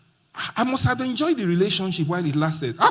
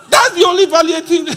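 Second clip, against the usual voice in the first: louder, higher, punching it out to the crowd; excited exclaim; 10 semitones higher, 7 dB louder, faster than usual. Real, not acted. Speaker exclaims to the audience, louder and higher. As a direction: burst out like it's the punchline.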